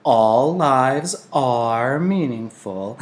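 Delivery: very slow, prim and proper. Acted. Old Larissa corrects herself to Jonas, primly and very slowly. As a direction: draw it out like a correct little lady. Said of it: "she added primly".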